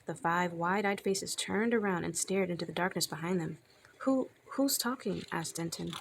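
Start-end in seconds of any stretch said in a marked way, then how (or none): 4.0-5.2 high, slow, timid question; startled stammer. A narrator's reading. Denton hears a stranger in the dark, startled stammer. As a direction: ask haltingly in a higher voice.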